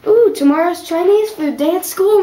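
loud and bright, voice up; thrilled at the news; excited. Real, not acted.

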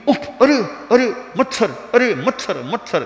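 startled by stinging bites; flustered yelps. Acted.

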